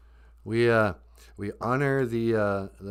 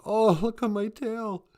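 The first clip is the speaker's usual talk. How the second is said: much higher, wailing cry; crying beaver; 12 semitones higher, 4 dB louder than usual. Acted. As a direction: wail over the ruined tail. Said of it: "And he started to cry".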